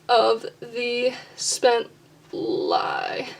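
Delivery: slow scansion, word by word; measured, separated words, testing each beat; sounding out stresses. Shown as a demonstration. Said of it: "where are the syllables that are being emphasized".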